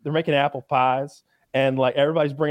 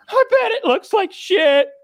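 much higher, louder, slow wailing voice; quoting the crying girl; 6 dB louder than usual. Retold or imitated. Contestant sobbing to Gordon, much higher, louder and slower. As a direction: wail it out in tearful despair. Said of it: "And she's like".

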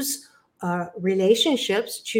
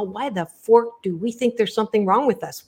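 usual speaking voice

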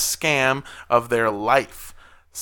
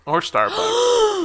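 much higher, slow silly echo; high mocking echo; much slower than usual. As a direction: squeak it back slowly. Mocking echo of friend's line.

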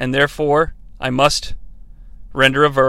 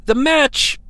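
air quotes; 8 semitones higher, slower than usual. Shown as a demonstration. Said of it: "I'm doing the finger quotes thing here".

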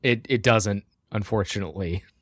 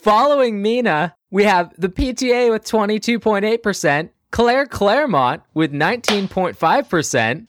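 election announcer; 9 semitones higher than usual. Acted. Reads election results like newscaster.

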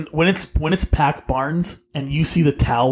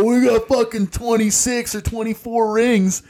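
high-pitched mock boasting; imitating argumentative fans; 7 semitones higher than usual. Host mimicking Habs fans in an argument, mock indignant. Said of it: "Every time you get into an argument".

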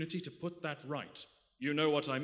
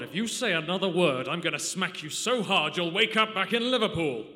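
louder, seething threat; menacing threat; 3 semitones higher, 8 dB louder than usual. Acted. Lacy threatening to smack the captain, menacing and louder. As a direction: threaten to hit him, meaning it.

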